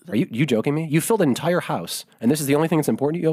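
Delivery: hammed-up astonishment at the wife; mock disbelief. Husband reacts to wife's two boxes with mock disbelief. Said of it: "and I went".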